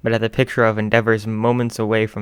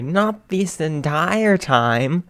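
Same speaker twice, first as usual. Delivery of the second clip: higher, very slow shy protest; timid defense; 7 semitones higher, much slower than usual. Acted. Co-host defensive.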